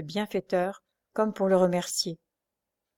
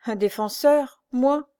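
"défenseur" louder, "moi" rising much higher; sighing disbelief; 6 semitones higher, 5 dB louder, slower than usual. A narrator's reading. Poor lame man doubting himself, sighing disbelief. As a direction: question "défenseur" in pained disbelief. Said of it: "soupira le pauvre boiteux".

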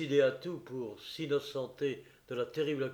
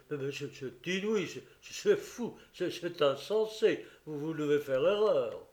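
stammering; 2 semitones higher, 3 dB louder than usual. Acted. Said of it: "balbutia en écarquillant les yeux".

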